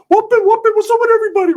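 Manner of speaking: overexcited falsetto greeting; Ricky. Retold or imitated. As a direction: greet everyone in a ridiculous high squeal. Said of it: "He thinks he's super like".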